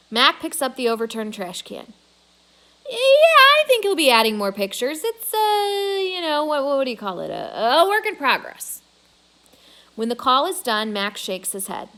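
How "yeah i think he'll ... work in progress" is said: eager bragging. A narrator's reading. Mac bragging on phone.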